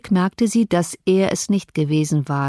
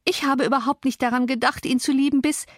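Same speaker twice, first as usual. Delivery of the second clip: plaintive protest; 6 semitones higher than usual. A narrator's reading. Girl protests, near tears.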